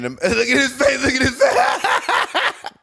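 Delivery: high, slow, stretched words through laughter; howling laughter. Real, not acted. Laughing at player's face.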